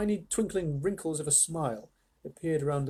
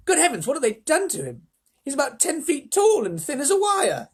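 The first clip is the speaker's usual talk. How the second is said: very high, appalled cry; shocked exclamation; 11 semitones higher, 5 dB louder than usual. A narrator's reading. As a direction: exclaim in high-pitched horror and amazement. Grandpa Joe appalled at Mike.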